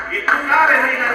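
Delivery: put-on loud, fervent preacher's declaration to his child; proclaiming to daughter. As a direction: declare it like a sermon, full voice. Father proclaims faith to sick daughter.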